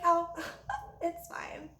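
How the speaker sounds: soft unvoiced giggle into "it's fine"; mock breathy waitress. Imitating the waitress shrugging off the rude customer. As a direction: brush it off with a tiny laugh.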